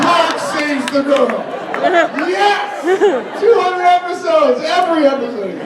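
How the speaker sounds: high, drawn-out mock amazement; hammed-up high-pitched ridicule; mock exclaiming. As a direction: exclaim it high as if it were big news. Mocking the too-generic audience answer.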